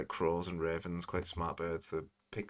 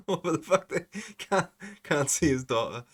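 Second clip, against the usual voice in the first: high, loud, real laughter in the words; laughing; 10 semitones higher, 7 dB louder than usual. Real, not acted. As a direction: laugh through the line, voice jumping up. Laughing in disbelief at the clip.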